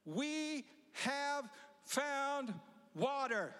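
very slow, high, exultant announcement; servant's excited cry. Acted. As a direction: stretch it out like joyful news. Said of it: "and said to him".

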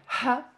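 "Ha" with a strongly blown H. Shown as a demonstration. Teacher showing the paper exercise, "Ha" with blown H. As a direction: puff air hard on the H of "Ha". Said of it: "the H that you blow".

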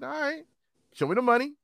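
high teasing dare; mock cocky taunt; cocky challenge. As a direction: call the bet with swagger. Confident challenge on a bet.

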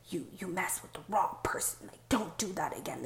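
soft, lightly voiced threat; hushed imitation of a menacing spirit; menacing inner voice. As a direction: imitate a haunting voice, kept quiet. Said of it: "it was this voice that kept".

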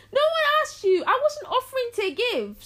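high, loud mock outrage; acted indignant protest. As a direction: protest in high-pitched disbelief. Acted protest at tax demand.

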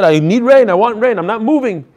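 demanding. Retold or imitated. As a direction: act it out as a stubborn demand, half-hearted. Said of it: "he was demanding".

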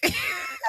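squealing burst of laughter; a delighted high squeal. Real, not acted. Laughing about the lipstick.

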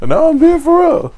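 squeaky, loud, slow protest; high insistent protest. Real, not acted. Insisting loudly, high pitched.

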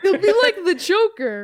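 slow, high and loud through laughter; laughing. Real, not acted. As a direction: laugh it out slowly. Laughing repeat of Joker line.

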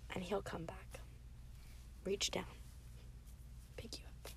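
almost a whisper, leaning in close; hushed comfort. Real, not acted. Preacher comforts struggling listeners, soft hushed whisper. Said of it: "comforting listeners in storm".

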